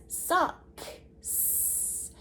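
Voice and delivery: socks whispered slowly, ks hissed at the end; slow whispered socks to show the x sound; whispered word. Shown as a demonstration. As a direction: breathe socks out slowly, leaning on the ks. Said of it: "it makes that X sound at the end".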